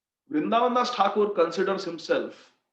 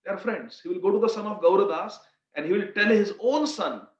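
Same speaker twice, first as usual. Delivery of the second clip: normal talking voice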